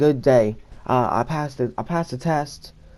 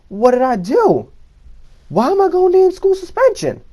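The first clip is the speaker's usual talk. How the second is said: incredulous outburst; 13 semitones higher than usual. Retold or imitated. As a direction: pitch leaps much higher in disbelief, retold. Outraged at being sent away.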